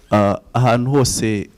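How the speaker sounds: ordinary talk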